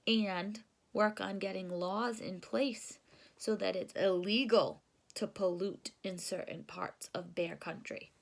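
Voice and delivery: ordinary delivery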